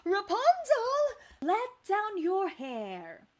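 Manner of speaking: high, loud call, slowing on the last phrase; lightly voiced old-lady call, drawn out at the end; copying old lady. A narrator's reading. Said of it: "tried to copy the old lady's voice".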